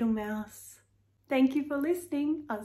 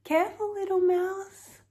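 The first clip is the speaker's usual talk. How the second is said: urgent warning; 6 semitones higher, 3 dB louder than usual. A narrator's reading. Warning the mouse in story.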